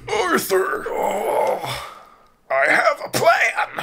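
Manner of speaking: Dutch van der Linde impression. Friend shows how badly he does the leader's voice, high and wailing. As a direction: wail it, pitch way up, stretched out. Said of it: "I'm not like".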